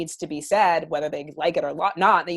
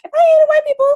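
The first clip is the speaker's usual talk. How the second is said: much higher, louder exasperated comeback; incredulous retort; 7 dB louder than usual. Retold or imitated. Answering critics who claim she hates whites, loud high retort. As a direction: fire back in disbelief. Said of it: "I'm like".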